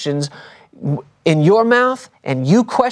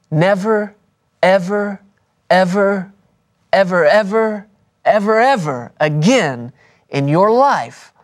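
each ever hit one by one; repeated never ever. Real, not acted. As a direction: hammer every ever with a pause. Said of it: "preacher hammers repeated never ever".

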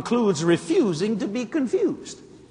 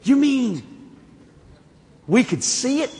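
high, louder astonished question; amazed; 7 semitones higher, 3 dB louder than usual. Retold or imitated. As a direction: ask it wide-eyed. Bankers voiced amazed.

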